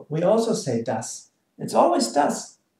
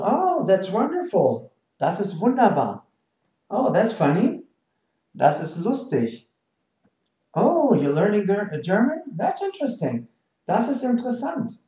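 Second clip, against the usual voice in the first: higher, swooping impressed reaction; acted delight; 4 semitones higher than usual. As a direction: react as if pleasantly surprised. Acted reactions as example phrases.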